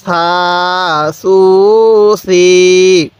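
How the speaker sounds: very slow, each vowel stretched long; drawn-out syllables. Shown as a demonstration. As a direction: stretch সা সু সি for a learner. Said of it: "তাহলে কিভাবে পড়ব?". (টেনে টেনে ধীরে উচ্চারণ)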